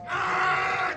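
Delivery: long high-pitched yell, drawn out; acted scream. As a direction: scream like you are leaning on a car horn. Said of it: "just scream".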